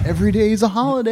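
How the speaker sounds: high, quick, sing-song delight; gleeful worker. Acted. Host imagining a fireworks job at the stadium, high gleeful voice. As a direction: chirp it like a happy worker.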